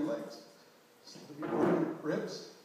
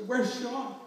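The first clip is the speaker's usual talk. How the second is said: high, loud desperate demand, louder than his first ask; desperate cry; 10 semitones higher, 9 dB louder than usual. Retold or imitated. Father shouting to his wife on the phone, desperate. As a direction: shout the question as panic takes over. Repeats the question, more desperate.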